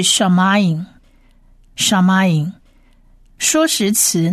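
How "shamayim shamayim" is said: "shamayim" stretched out slowly, every syllable clear; teacher modelling "shamayim" slowly; slow word modelling. Shown as a demonstration. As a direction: sound out "shamayim" for beginners. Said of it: "第二个字是".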